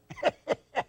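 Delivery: high, chattering puppet laugh; dummy's cackle. Acted. Dummy laughs at own riddle, high cackle.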